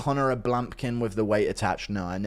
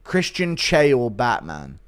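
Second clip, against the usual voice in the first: normal talking voice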